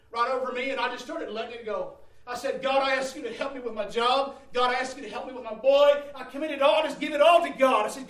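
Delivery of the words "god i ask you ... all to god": loud, rising pleading prayer; fervent prayer. Retold or imitated. Preacher reliving his prayer at the pulpit, loud and pleading. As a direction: cry out to God for help.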